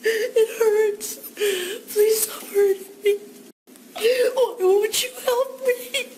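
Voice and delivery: put-on very high, crying child's voice calling for its mother; panicked baby elephant. Orphaned baby elephant sobs for its killed mother, high wailing cry. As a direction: wail like a lost, terrified baby. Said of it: "a panicked baby elephant".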